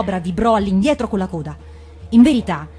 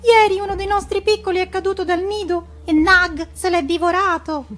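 sorrowful little bird; 11 semitones higher, 3 dB louder than usual. Acted. Darzee answering the mongoose about his lost chick, high and mournful. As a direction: lament it as a grieving tailorbird. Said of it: "rispose Darzee".

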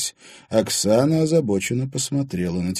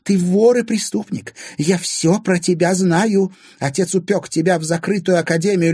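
high, loud, angry tirade; Anfisa, accusing; 10 semitones higher, 6 dB louder than usual. A narrator's reading. Stepmother calling Timofey a thief, furious. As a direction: hurl accusations in a high woman's voice.

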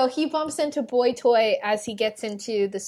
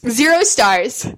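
mock verdict; 3 semitones higher, 13 dB louder, slower than usual. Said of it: "Just negative stars all around".

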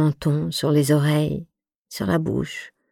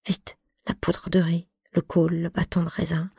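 hurried whisper; 5 dB quieter than usual. Acted. Hurried self-talk at the mirror.

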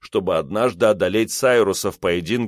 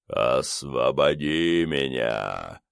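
deep, slow otherworldly rumble; dark lord; 7 semitones lower, 4 dB quieter, much slower than usual. A narrator's reading. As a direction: rumble it like a voice from the grave. Skull monster speaks.

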